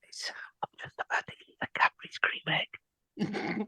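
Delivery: mock sexy whisper; ASMR whisper. Shown as a demonstration. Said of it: "Some A S M R for you".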